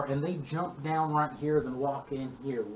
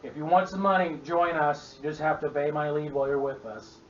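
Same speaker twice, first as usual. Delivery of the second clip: loud, raised goblin boss voice; goblin boss yelling; 2 semitones higher, 7 dB louder than usual. Acted. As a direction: call out like a bossy goblin chief. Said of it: "he yells at you".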